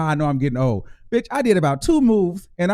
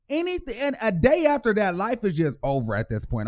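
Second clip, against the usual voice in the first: ordinary talk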